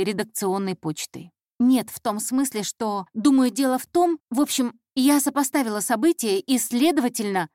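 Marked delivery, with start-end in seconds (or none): 1.6-7.5 louder nervous intern, circling the point; nervous, rambling girl. Acted. Young intern explaining herself to her boss, nervously rambling. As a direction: stumble around the point, anxious to explain. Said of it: "Девушка нервничала, ходила вокруг да около".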